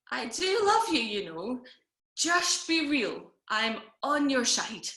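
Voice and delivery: put-on drawn-out, swooping drunken reassurance; slurring drunk pleading. Drunk sister reassures her brother through whiskey blur, slurring insistently. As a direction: slur it with big tipsy emphasis. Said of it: "slurring a little bit".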